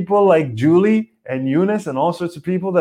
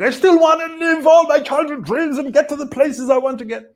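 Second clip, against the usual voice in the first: higher, eager restless self; a pushy inner voice, pitched up; 12 semitones higher than usual. Retold or imitated. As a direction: voice the itch to do more. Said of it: "the other part of me is restless".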